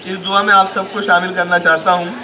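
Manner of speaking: normal talking voice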